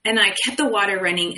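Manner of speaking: ordinary talk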